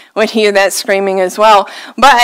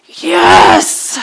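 triumphant yes. Retold or imitated. Churchgoers gloating over a broken car, triumphant yes. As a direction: celebrate like you won. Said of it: "we just kinda go".

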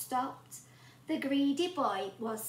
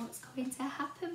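usual speaking voice

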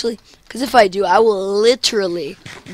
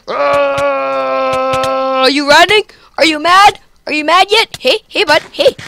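higher, louder mocking are you mad; shouted taunt; 4 semitones higher, 5 dB louder than usual. Real, not acted. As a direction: jeer at him as he runs. Taunting running opponent.

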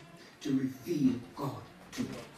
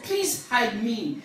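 mildly raised, drawn-out plea; slow, preached cry for shelter; defiant plea, only lightly shouted; defiant shout back; 7 semitones higher, 9 dB louder, slower than usual. Acted. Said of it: "Defiantly, I might shout back".

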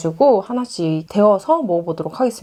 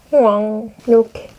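normal talking voice

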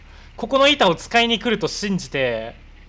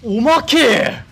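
drawn-out shout; 4 semitones higher, 6 dB louder, much slower than usual. Real, not acted. In-game shout.

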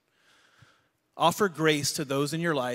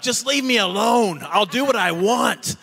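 retold angry yell; 8 semitones higher, 10 dB louder than usual. Dad yelling back at his son in the car, loud and angry. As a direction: snap it at your son like you're done arguing. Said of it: "so I'm yelling".

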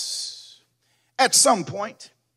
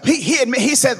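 ordinary talk

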